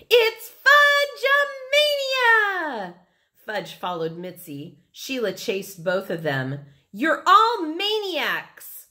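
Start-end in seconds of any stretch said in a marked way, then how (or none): 0.1-2.9 very high, drawn-out gleeful shout. A narrator's reading. Mitzi races away from Libby, shouting. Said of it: "She raced off shouting". 6.9-8.9 high, loud, drawn-out accusation; shout. A narrator's reading. Libby yells at the chasing kids, exasperated shout. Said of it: "Libby shouted".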